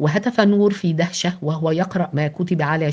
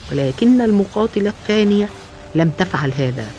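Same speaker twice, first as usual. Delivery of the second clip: plain reading voice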